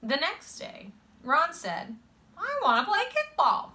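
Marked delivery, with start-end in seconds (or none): 2.4-3.7 put-on high-pitched kid's plea; eager child. A narrator's reading. Boy asking the kids the next day, high and eager. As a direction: pipe up high like an eager kid.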